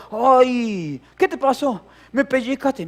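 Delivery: loud, high mock yelp and retort; high-pitched comic replay of kids bickering; retold pinched playmate. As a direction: act out a childish squabble over a pinch. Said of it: "lo pellizcaba y decía".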